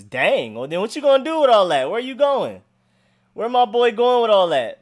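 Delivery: hyped up, teasing disbelief; excited. Real, not acted. Reacts to nine hundred horsepower.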